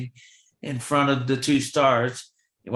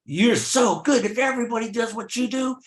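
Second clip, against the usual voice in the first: excited praise; 9 semitones higher than usual. Retold or imitated. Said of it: "And he said".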